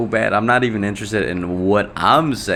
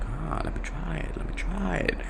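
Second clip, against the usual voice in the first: retold tentative try; 8 semitones higher, 9 dB quieter than usual. Retold eager reaction to trying exercise.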